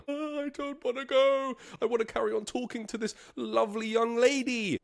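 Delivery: high, whining protest; whiny refusal. Retold or imitated. Resisting the bouncer.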